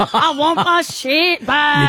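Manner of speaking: high, slow wail in muddled Irish-Scottish; Irish going Scottish. Acted. Farmer wants sheep back, plaintive Irish-Scottish accent. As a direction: drag it out in a bad Irish-turned-Scottish accent. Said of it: "You're going Scottish".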